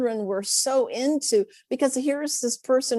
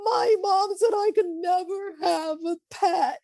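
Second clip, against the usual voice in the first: put-on high, plaintive small girl voice; sad little girl; 10 semitones higher than usual. Teacher imitating a girl who can't have a pet, high and plaintive. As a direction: play a little girl sadly confessing. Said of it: "she looks at me and says".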